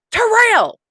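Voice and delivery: high, loud mock shout for a child; imitated holler. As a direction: holler the name across a boat, imitating her. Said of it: "she's shouting it".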